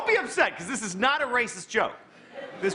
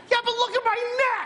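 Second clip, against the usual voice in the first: excited giraffe; 14 semitones higher than usual. Acted. Giraffe calling down to the horse, high and amazed. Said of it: "giraffe and horse dialogue".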